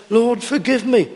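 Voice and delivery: flippant prayer. Retold or imitated. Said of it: "Some people make it a light matter".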